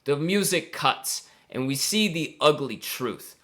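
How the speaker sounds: low, grave telling with weighty pauses; solemn dramatic narration. Pretend. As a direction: let the tragic truth land phrase by phrase. Mock-solemn narration of the downfall.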